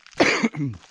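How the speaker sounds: a sharp loud cough. Real, not acted. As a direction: clear the throat with a cough. Cough mid-sentence.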